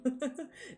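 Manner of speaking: quick high giggle; a little giggle; short laugh. Real, not acted. Laughs again.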